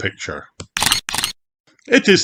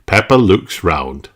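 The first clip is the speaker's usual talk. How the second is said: usual speaking voice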